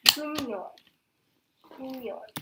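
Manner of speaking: "gumyon" with m carried into yon; demonstrated pronunciation. Shown as a demonstration. Teacher modelling the first word, linked pronunciation. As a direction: say "gumyon" as gu-myon. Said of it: "here you pronounce this first word".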